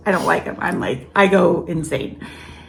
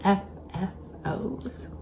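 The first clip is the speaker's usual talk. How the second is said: soft unvoiced aside; quiet whispered aside; 8 dB quieter, slower than usual. Real, not acted. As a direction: whisper the abbreviation.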